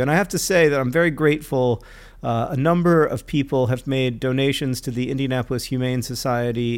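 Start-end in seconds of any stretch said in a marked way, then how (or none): none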